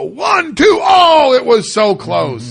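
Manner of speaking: loud, high arena crowd; crowd count. Retold or imitated. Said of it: "their role is to go".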